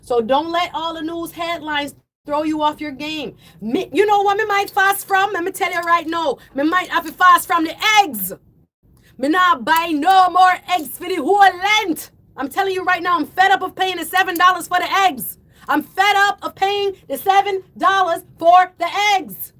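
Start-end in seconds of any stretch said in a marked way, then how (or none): 3.6-13.2 raised, exasperated voice; worked-up yelling. Real, not acted. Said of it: "I gotta stop yelling". 13.2-15.4 fed-up outburst in one run; raised, exasperated voice at normal pace; worked-up yelling. Real, not acted. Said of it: "I gotta stop yelling". 15.7-19.5 fed up, hammered out word by word; emphatic yelling. Real, not acted. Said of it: "I gotta stop yelling".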